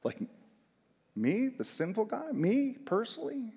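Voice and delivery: mock amazed question; incredulous. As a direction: ask as if it can't be true.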